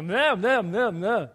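lightly imitated toddler babble; toddler babbling. Dad mimics his two-year-old's babbling, lightly voiced toddler. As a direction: mimic a toddler's nonsense sounds. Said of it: "saying all these weird words and babbling".

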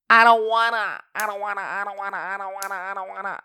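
loud burst, then rattled off nonstop; insistent refusal. Real, not acted. Podcaster refusing to explain herself to listeners, stubborn rapid-fire refusal. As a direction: repeat it rapidly like a stubborn protest.

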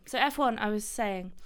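ordinary talk, nothing marked

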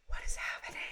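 awed hushed whisper. Retold or imitated. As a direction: breathe it out in amazement. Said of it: "I was like".

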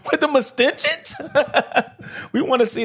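high-pitched, cracking up while talking; real laughter breaking through the words; laughing. Real, not acted. Podcaster cracking up at own joke about extensions, laughing.

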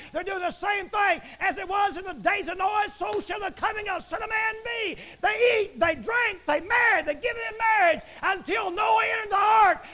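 high, loud, full-force preaching; shouting preacher. Acted. Preacher condemning idol gods to his listeners, shouting. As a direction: thunder the commandment at the congregation. Preacher builds on days of Noah.

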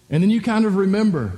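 normal talking voice

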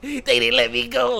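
imitating the actress. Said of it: "She's like".

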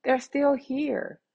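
gushing to herself about loyal listeners, slowing at the end; delighted, high-pitched retold reaction; delighted gasp. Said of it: "I'm like".

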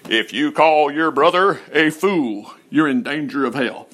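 slow, solemn mock preacher; pulpit preacher. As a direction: intone a grave warning from the pulpit. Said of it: "you'll hear from the pulpit".

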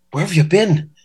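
a lightly Irish bodyguard; a hint of an Irish brogue; Irish accent. Bodyguard asking the fan where he's been, lightly Irish accent. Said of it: "how's my Irish accent?".

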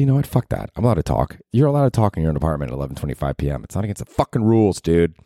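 normal talking voice

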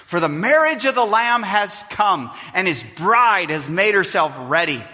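loud, soaring scripture reading at full preaching force. Acted. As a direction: proclaim it to the whole hall like a vision.

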